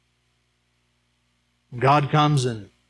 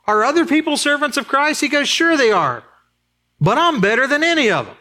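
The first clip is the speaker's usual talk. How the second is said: high, proud mock boast; boastful Paul; 11 semitones higher than usual. Preacher voicing Paul's bold boast to the church, in high mock pride. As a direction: puff up like a braggart apostle. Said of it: "That's kind of bold".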